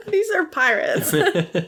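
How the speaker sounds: high-pitched, laughing reproach; hammed-up despairing protest; mock exasperation. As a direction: scold a clueless friend through laughter. Acted exasperated scolding of clueless captain.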